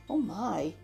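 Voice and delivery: ordinary delivery, nothing marked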